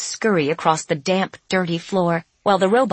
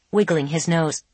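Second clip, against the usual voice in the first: ordinary delivery, nothing marked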